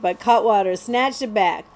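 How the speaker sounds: usual speaking voice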